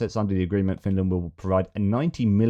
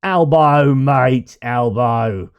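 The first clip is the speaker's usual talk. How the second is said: calling out loud and higher in a mock Australian accent; 5 semitones higher, 8 dB louder, much slower than usual. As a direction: hail your mate Albo with a big Australian yell. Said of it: "those accents I get in trouble for".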